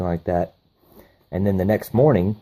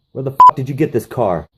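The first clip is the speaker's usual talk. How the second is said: angry demand; 4 semitones higher, 6 dB louder than usual. Retold or imitated. Chop shop owner confronting thug.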